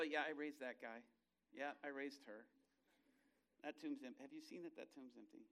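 low, offhand, understated; casual bragging. Acted. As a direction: toss it off quietly while strolling around. Jesus strolls, casually claiming resurrections.

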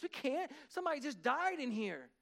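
high-pitched, flustered protest; retold alarmed objection; panicking pastor. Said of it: "'cause they were panicking".

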